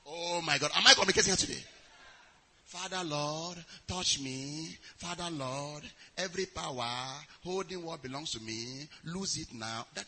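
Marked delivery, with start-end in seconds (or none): 2.6-9.9 soft, limp mock prayer; half-hearted prayer. Preacher mocking a lukewarm believer's prayer, softly. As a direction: pray it like you don't mean it.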